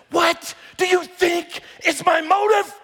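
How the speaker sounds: high, loud, slow demanding question; exasperated shout. Retold or imitated. Parent pressing a stubborn child, loud and fed up. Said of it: "And I've said it just like that".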